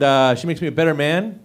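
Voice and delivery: ordinary talk, nothing marked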